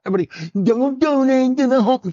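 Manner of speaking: high, put-on holler; retold high-pitched public appeal; mock announcement. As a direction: call it out high, hamming it up. Joking shout in a story.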